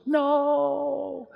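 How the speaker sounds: put-on high child's protest; children crying out. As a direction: shout it like a little kid in the audience. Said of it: "all the little kids go".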